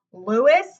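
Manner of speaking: loud calling out his name; shocked call. A narrator's reading. Parents shocked at the construction crew, calling Lewis loudly. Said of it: "they cried".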